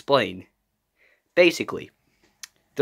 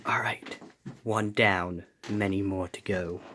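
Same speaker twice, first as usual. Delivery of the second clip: whispering; 8 semitones lower, 10 dB quieter than usual. Acted. Imposter after a kill.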